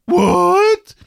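very high, loud put-on "what"; imitated voice. Shown as a demonstration. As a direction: squeal it in a caricature voice. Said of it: "if I could do it, the voice".

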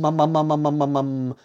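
droning hum on one held note; sustained monotone humming; humming tops. A narrator's reading. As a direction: imitate spinning tops with a steady drone. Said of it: "Some would hum".

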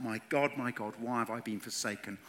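ordinary talk, nothing marked

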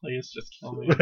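very soft, resigned plea; defeated husband. Retold or imitated. Husband dragging his wife away, quiet despair. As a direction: say it under your breath, dead inside. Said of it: "you can see the husband going".

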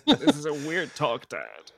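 put-on slow, drawn-out kid voice. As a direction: whine it slowly like a son. Son reacts to dad.

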